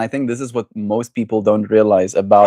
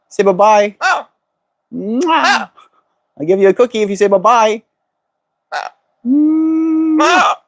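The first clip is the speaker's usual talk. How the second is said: bribing; 9 semitones higher, 3 dB louder than usual. Real, not acted. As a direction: coax the bird, high and bright. Bribing bird with cookie.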